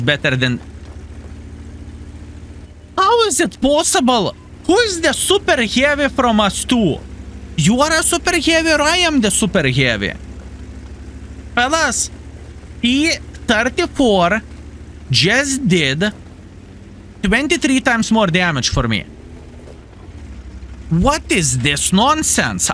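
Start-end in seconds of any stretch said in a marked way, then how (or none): 3.0-10.1 high, incredulous outrage; outraged disbelief. Real, not acted. Player outraged at a teammate's heavy tank, high disbelief. 11.5-19.1 high indignant complaint to the fellas. Real, not acted. Streamer complaining to viewers about a teammate, high indignant. 21.0-22.7 slow, high, indignant demand; mock indignation. Real, not acted. Streamer protesting a teammate's damage, slow mock indignation.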